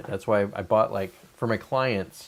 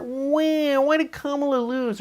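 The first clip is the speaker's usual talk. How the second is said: high, slow, self-pitying whine; mock the person who feels everyone is mean to them; a high whiny poor-me voice; whiny victim voice; slower than usual.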